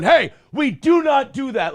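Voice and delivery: firm, mildly angry telling-off; retold getting mad. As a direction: scold the kid, losing patience. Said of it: "Like, I'm getting mad".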